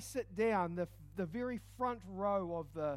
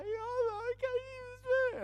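much higher, slow and drawn out; mock teary lady; 5 dB louder, much slower than usual. Crying passenger answers the flight attendant, higher and drawn out. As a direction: play the weepy passenger insisting she's fine. Voicing the crying lady's reply.

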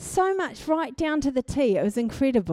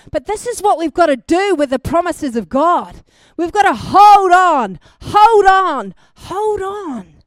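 much louder ringing cry of hold on; full-force preaching; 7 semitones higher, 12 dB louder than usual. Acted. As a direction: drive the refrain home to the whole room. Climax of sermon.

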